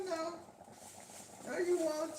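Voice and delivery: usual speaking voice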